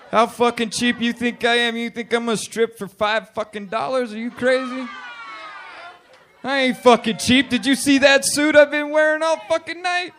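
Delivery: high, loud, affronted protest; mock indignation. Comedian offered five dollars to strip, high mock outrage.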